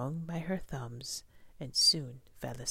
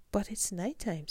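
lightly squeaked high protest; a touch of a small bat's squeak; 7 semitones higher than usual. A narrator's reading. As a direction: pitch it up in mild protest. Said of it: "Stella Luna squeaked".

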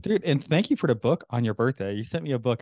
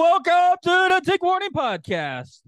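very high, slow announcer voice; 5 dB louder, slower than usual. Shown as a demonstration. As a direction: stretch it out like a cheesy host. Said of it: "Come on, [name]".

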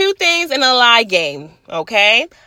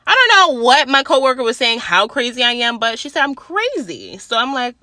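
normal talking voice

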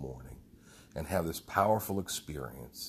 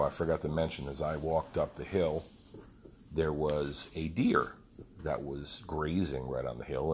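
normal talking voice

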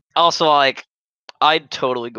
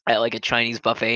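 normal talking voice

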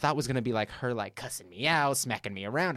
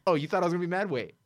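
mom's clapback; 8 semitones higher, faster than usual. Retold or imitated. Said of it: "She was like".

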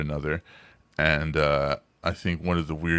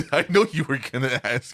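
laughing; 6 semitones higher, 4 dB louder than usual. Real, not acted. Laughing at listener's expected request.

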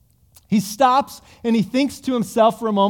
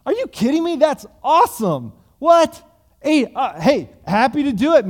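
higher and louder excited yelp; thrilled debtor; 5 semitones higher, 3 dB louder than usual. Acted. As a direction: burst out with glee at the deal.

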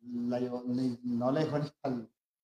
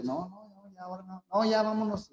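un toque de novio alterado, más agudo; imitando al novio; 7 semitones higher than usual. Retold or imitated. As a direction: imita al novio que se echa para atrás. Novio imitado que se niega. (novio imitado, más agudo, negándose a tatuarse)

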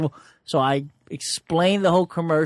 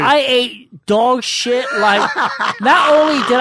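laughing; 8 semitones higher, 7 dB louder than usual. Real, not acted. Laughing retelling bombing.

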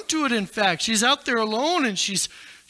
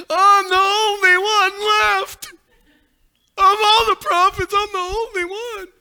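self-pitying whine; 6 semitones higher, 3 dB louder than usual. Acted. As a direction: moan it like a sulking prophet alone in a cave. Elijah moping in a cave.

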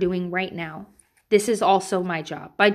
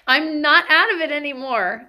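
laughing; 7 semitones higher, 4 dB louder than usual. Real, not acted. Mom laughing at her own past self, higher and louder. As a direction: laugh then higher, louder amused line.

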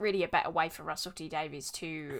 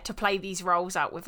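ordinary talk, nothing marked

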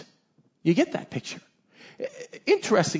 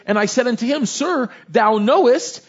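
slow, raised, ringing Bible reading; louder, deliberate preacher's recitation; scripture reading; 6 semitones higher, 5 dB louder than usual. A narrator's reading. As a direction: proclaim each phrase with weight. Reads scripture aloud to congregation.

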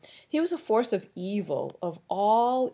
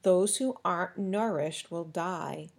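plain reading voice

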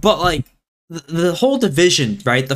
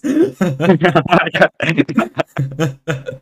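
burst of laughter; 2 semitones higher than usual. Real, not acted. Laughing after mock ad.

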